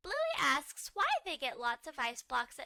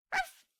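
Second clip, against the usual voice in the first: puppy yap; 5 dB louder than usual. Acted. Socks the puppy barking a guess.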